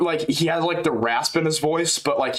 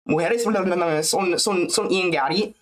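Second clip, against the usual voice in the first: higher-pitched mock Spanish trash talk; pitched-up mocking imitation in Spanish; Morales; 7 semitones higher than usual. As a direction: imitate a Spanish-speaking fighter talking back. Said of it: "That's Morales talking back to him".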